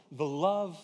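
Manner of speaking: ordinary talk, nothing marked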